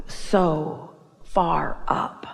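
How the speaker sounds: very slow, stretched words with long gaps; drawn-out awe. Retold or imitated. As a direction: draw out each word to make the height felt. Dwelling on a felt height.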